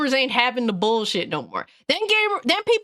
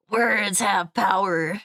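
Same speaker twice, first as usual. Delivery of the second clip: trailer voice; 4 semitones lower, slower than usual. Retold or imitated. Mocking the trailer's line.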